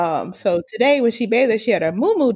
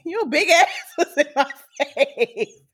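laughing; 5 semitones higher than usual. Retold or imitated. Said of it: "She said".

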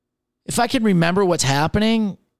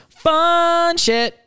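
high, loud squeal on Fun shit; a giddy, much higher yelp; excited squeal; 12 semitones higher, 4 dB louder than usual. Acted. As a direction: squeal it out gleefully. Repeats phrase, then squeals it.

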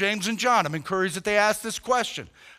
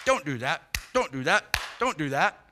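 mock nagging; 5 semitones lower than usual.

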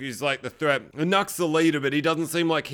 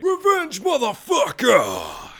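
high, hammed-up battle yell; gleeful revenge cry; 8 semitones higher, 4 dB louder, much slower than usual. Can't wait for pure revenge, so a high, gleeful battle cry. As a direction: launch high and stretch the last word out. Excited narrator cheers on revenge.

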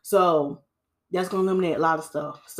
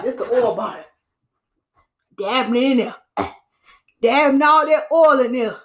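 hammed-up mock of someone dabbing oil, louder at the end; mocking clients; 3 semitones higher, 4 dB louder than usual. Stylist mocks clients who overload their hair with oil, hammed-up and loud. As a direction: mimic a client slathering on oil.

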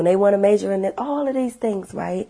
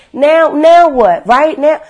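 loud, slow, higher frustrated demand; retold anger; 7 semitones higher, 10 dB louder, slower than usual. Reliving being mad at mom, loud slow frustrated demands. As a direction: relive the frustration, dragging the words. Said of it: "I'm, I'm mad".

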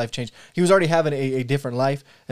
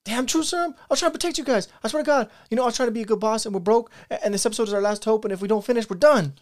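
much higher, frantic blurting; panicked confession; 8 semitones higher, faster than usual. Acted. Boss confessing the show is broke, high panicked blurt. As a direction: spill the secret in panic.

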